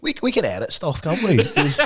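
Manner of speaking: normal talking voice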